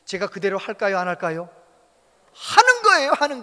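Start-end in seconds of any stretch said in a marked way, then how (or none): 2.3-3.4 very high, loud shouted answer; preaching shout. Real, not acted. Preacher shouting the answer at the congregation, loud. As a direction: fire the answer back at the congregation.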